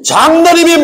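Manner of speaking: sharp, loud, high retort; irritated retort. Retold or imitated. Pastor retorting at the deacon in a mock quarrel, sharp and loud. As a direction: snap back at the deacon, irritated.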